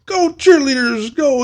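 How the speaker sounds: high, drawn-out pep chant; mock cheer. Scare actor mimicking the crew's cheer for cheerleaders.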